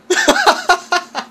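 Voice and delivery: high laughing outburst; loud burst of laughter. Real, not acted. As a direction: crack up loudly. Finds porn site in game. (gargalhada alta)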